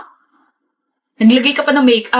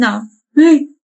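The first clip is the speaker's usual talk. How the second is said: usual speaking voice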